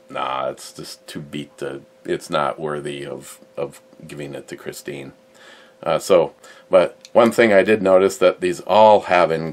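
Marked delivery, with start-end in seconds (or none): 0.1-5.1 low, very soft retold dismissal; dismissive letdown. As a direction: drop the voice, imitating a friend quietly turning it down. Retells Ed's let-down phone call.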